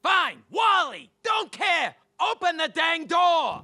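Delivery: loud, high, fed-up yell; angry shout. Acted. Yelling through the door at his brother, loud. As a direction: bang on the door with your voice.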